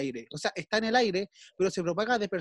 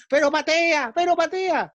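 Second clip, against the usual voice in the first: much higher, louder mock fan shout; fan yelling; 9 semitones higher, 4 dB louder, slower than usual. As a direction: scream it at the striker on TV. Fan yelling at football match. (hincha gritándole al jugador, mucho más agudo y fuerte)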